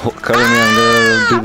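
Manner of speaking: loud urgent yell to teammate; cover me shout. Real, not acted. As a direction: shout it over the gunfire. Shouts to teammate under fire.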